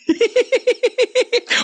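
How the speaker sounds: very high sneering cackle; evil laugh. Acted. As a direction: cackle shrill with glee. Villain laughs at his guest.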